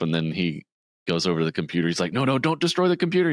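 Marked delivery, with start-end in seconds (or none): 2.1-3.3 mock panicked plea. Peter panics quoted.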